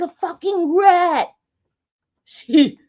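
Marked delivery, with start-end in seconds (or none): 0.0-1.3 indignant. Retold or imitated. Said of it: "I'm like".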